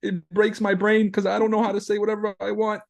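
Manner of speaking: high whiny voice telling a therapist; mock whiny softie. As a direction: whimper like a rattled softie. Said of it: "Be like".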